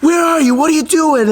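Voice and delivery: very high, loud panicked mother; imitating mom's frantic demand. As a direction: shriek it like a worried mother. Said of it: "She instantly answers, like".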